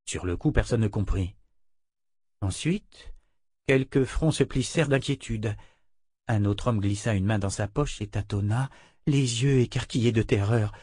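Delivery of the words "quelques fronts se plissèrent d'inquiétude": usual speaking voice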